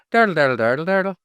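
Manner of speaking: silly stage-Irish babble; bad Irish accent. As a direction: rattle off a hammed-up Irish lilt. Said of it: "That's not what you do to Irish".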